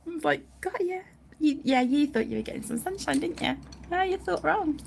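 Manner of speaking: ordinary delivery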